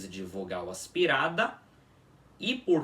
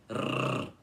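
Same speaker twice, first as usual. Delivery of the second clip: rrr rolled on the tongue against the teeth; trilled R sound. Shown as a demonstration. Teacher showing how the R trill sounds, tongue on teeth. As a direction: roll a long trilled rrr without scraping the throat. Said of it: "encostando a língua nos dentes e tremendo".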